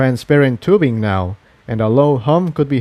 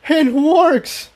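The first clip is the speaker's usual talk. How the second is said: much higher, triumphant mock shout; shout over noise. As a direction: call out over roaring engines. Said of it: "Jigsaw shouted over the noise".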